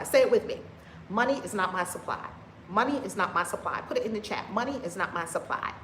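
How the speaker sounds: normal talking voice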